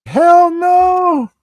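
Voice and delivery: high, loud outraged refusal; mock outraged shout. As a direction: blurt it out, scandalised. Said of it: "You're like".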